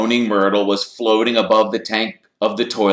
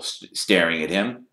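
plain reading voice

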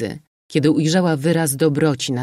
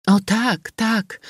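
płacząc; 7 semitones higher, slower than usual. A narrator's reading. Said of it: "rzekła płacząc".

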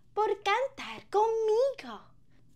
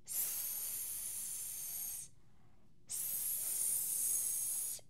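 long whispered sss; snake hiss; 24 dB quieter than usual. Shown as a demonstration. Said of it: "Así hace la serpiente".

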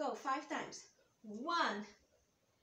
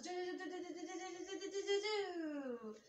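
high buzzing helicopter noise; helicopter sound; 4 semitones higher than usual. Pretend. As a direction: hum like spinning rotor blades. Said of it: "Let's go helicopters".

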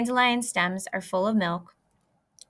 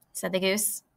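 ordinary delivery, nothing marked

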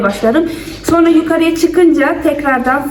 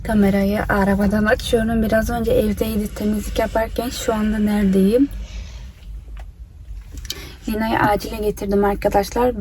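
quiet voice; 3 semitones lower, 6 dB quieter than usual. Real, not acted. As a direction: keep it low so she sleeps. Quiet talk in car, child asleep. (alçak sesle konuşma)

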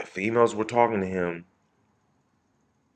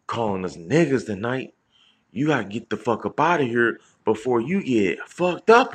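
raised, higher voice re-enacting a threat; retold louder confrontation; 6 semitones higher, 6 dB louder than usual. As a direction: act out telling someone off, getting louder. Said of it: "and I just got louder".

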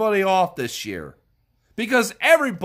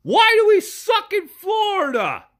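incredulous outcry; 9 semitones higher than usual. Real, not acted. Exasperated outburst in a rant.

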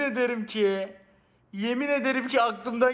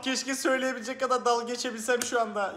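ordinary delivery

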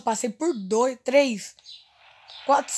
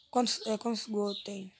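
whispering; 3 semitones lower, 9 dB quieter than usual. Real, not acted. Checking stats under breath.

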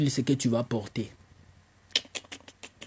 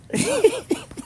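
high squealing laugh. Real, not acted. Laughing at his own joke. (éclat de rire aigu)

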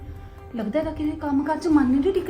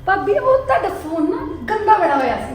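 giggling through the excuse; nervous laughing; 7 semitones higher, 8 dB louder than usual. Acted. Fake-sweet excuse to sister-in-law.

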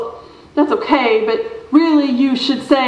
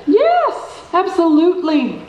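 yes jumping far higher; eager yes; 5 semitones higher than usual. Acted. Director proudly defending her Hamlet, eager yes. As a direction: leap at the question happily.

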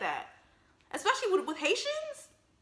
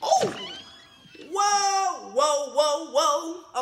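loud, high burst of shock; shocked outcry; 5 semitones higher, 7 dB louder, much slower than usual. Real, not acted. Viewer hears boy call his mom dummy, shocked outcry. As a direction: cry out in alarm at the insult.

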